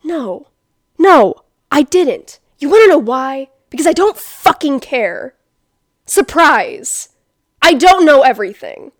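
loud, fed-up comeback; loud sarcastic outburst; exasperated retort. Pretend. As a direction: snap back at a know-it-all. Imagined angry retort to friend.

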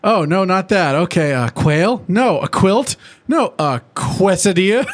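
rapid-fire guesses as a ditzy pony; mock Pinkie Pie; imitating Pinkie Pie. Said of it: "she's just like".

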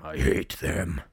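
low, drawn-out loathing, imitating him; hateful emphasis. Retelling the driver's rant about women, hateful and low. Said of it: "his mood and tone starts to change".

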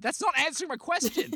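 high, loud exasperation; mock fed-up yell; frustrated retort. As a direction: burst out at the evasive host. Frustrated detective snaps at staff.

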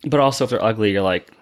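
ordinary talk

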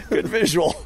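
words through laughter; laughing. Real, not acted. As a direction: say it while laughing. Laughing at joke.

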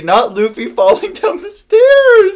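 high squeal of laughter at the dog; laughing. Real, not acted. Friend hearing about the dog falling, laughing high. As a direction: laugh the words out high and loud.

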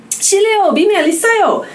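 high, rushed bad Korean accent, out of breath; breathless bad Korean. A breathless foreigner rushes into a shop in bad Korean. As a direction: blurt it in clumsy learner Korean, breathless. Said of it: "in a really bad accent".

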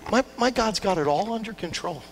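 ordinary talk

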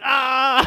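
high, wailing imitated cry; imitated wail after a fall; hammed-up high "ah" of pain; mock cry of pain. Retold cry after hitting head.

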